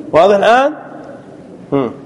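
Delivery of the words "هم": usual speaking voice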